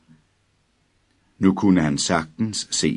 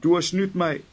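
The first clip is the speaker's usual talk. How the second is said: high, wailing, near tears; whining lament; 13 semitones higher than usual. A narrator's reading. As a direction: wail the accusation, about to cry. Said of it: "jamrede drengen". (drengen jamrer højt, tæt på gråd)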